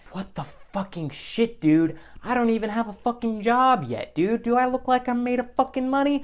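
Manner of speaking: put-on outraged broke kid; exasperated inner rant. Inner rant over fast food prices.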